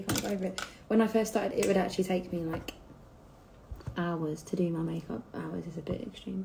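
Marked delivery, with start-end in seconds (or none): none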